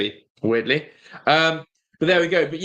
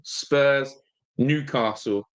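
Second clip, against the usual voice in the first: ordinary talk, nothing marked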